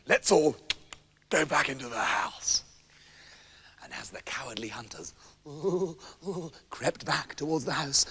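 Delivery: hushed creeping whisper. A narrator's reading. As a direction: whisper as if sneaking up. Hunters sneaking back.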